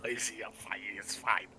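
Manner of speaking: unvoiced murmured aside; whisper. Owner whispering a reassurance aside. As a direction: whisper it like a secret.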